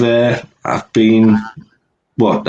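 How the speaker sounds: ordinary delivery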